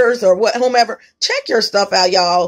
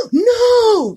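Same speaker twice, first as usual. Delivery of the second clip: mock astonished cry; 12 semitones higher than usual. Acting out amazed reaction.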